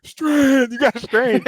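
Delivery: high, loud, slow coach's bark; Coach Heater impression. Former player imitating his coach yelling at linemen to strain, high and loud. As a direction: bellow it like an old-school defensive coach. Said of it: "I love the fact that I'm getting, like, the impression too".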